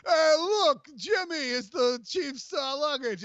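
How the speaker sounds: a hammed-up Boston accent calling to a buddy. Imitating a Boston airport worker shouting to a coworker, mock Boston accent. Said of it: "my Boston accent".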